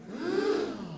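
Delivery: high, sharp gasp of shock. Acted. Queen gasps at the reveal, a high, sharp gasp of shock. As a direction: gasp in disbelief.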